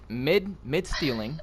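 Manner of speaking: ordinary delivery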